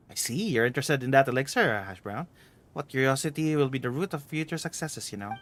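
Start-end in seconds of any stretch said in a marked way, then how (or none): none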